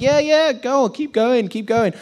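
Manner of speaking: high-pitched, hurried goading; mock egging-on. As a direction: urge them on teasingly. Acted egging-on of praying rivals.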